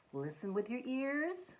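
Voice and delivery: ordinary delivery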